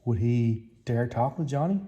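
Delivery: plain reading voice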